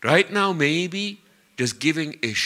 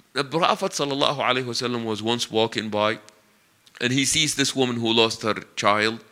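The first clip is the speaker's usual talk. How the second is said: ordinary delivery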